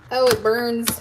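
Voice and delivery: onion sting pained cry; real pained onion-eye complaint. Real, not acted. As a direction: wince through burning eyes. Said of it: "the tears are getting ready to start".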